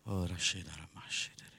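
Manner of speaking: low, hushed praying in tongues; whispered prayer. Real, not acted. Preacher praying in tongues to himself, whispered. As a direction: murmur the syllables very softly. Prays in tongues between calls.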